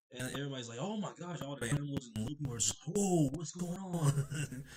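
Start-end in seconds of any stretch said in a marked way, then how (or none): 0.8-1.4 high-pitched mock gasp of words; astonished. Onlookers reacting to Luffy's attack, astonished. 3.0-4.6 high, drawn-out mock alarm; startled. Animals watching Luffy suddenly attack, startled.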